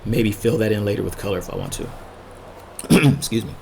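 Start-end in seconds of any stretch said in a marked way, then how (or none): 1.9-2.8 quick rough throat clear. Real, not acted. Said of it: "losing my voice". 2.9-3.5 slightly hoarse, voice going. Real, not acted. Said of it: "losing my voice".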